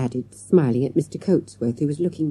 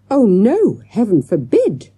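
loud, high protest "Oh, no, heaven forbid"; cried out; 7 semitones higher, 7 dB louder than usual. A narrator's reading. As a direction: exclaim it in alarm, loud and high. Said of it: "cried Mister Coatsworth".

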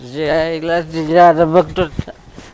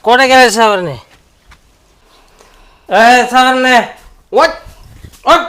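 calling out; 3 semitones higher, 5 dB louder than usual. Acted. Husband calling for wife.

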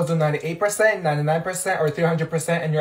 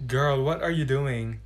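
ordinary delivery

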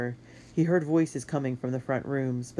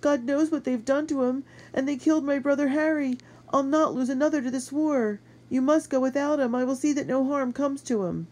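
very high, impassioned woman's outburst; anguished cry; 12 semitones higher, 4 dB louder than usual. A narrator's reading. Sister protecting her wounded brother from the boy, anguished high cry. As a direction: cry out, pleading and fierce. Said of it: "she cried".

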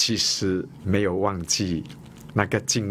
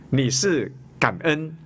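ordinary delivery

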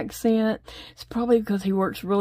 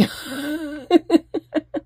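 tired laugh; 8 semitones higher than usual. Real, not acted. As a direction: laugh it off after the effort. Laughs after tiring rows.

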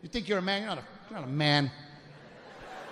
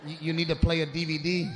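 usual speaking voice

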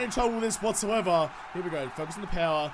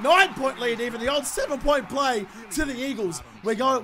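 usual speaking voice

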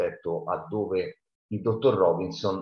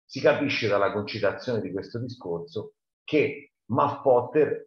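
usual speaking voice

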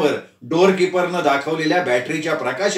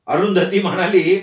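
ordinary delivery

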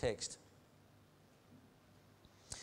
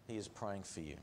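softer, lower murmured assurance; quiet; 7 semitones lower, 11 dB quieter than usual. Acted. Preacher murmuring the promise to the congregation, hushed. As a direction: let the last words fall soft. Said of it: "And he's praying for you".

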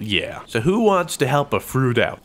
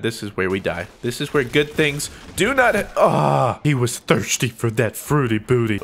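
normal talking voice

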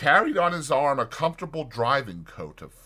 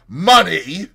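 loud, high, indignant exclamation; outraged cry; 7 semitones higher, 13 dB louder than usual. A narrator's reading. Fetz spurns the doctor's offer, crying out indignantly. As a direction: cry it out as an insult thrown back. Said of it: "cried Fetz".

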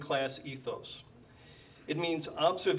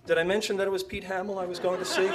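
fast, higher, laughing aside; laughing rush; 5 semitones higher, 4 dB louder, much faster than usual. Acted. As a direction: rattle it off with a giggle. Comic rush of name-dropping.

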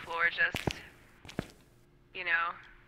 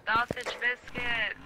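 slow, higher mock child's cry; screaming kid imitation; 4 semitones higher, slower than usual. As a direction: draw it out like a kid calling out. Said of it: "a little kid at the freezer section screaming".

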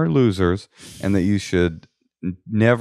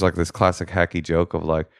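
normal talking voice

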